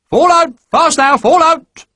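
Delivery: high, loud bawled orders; bawling sergeant. Acted. Sergeant bawling at the column to fall out, loud high. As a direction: bawl it down the road. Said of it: "Sergeant Havercamp was suddenly bawling".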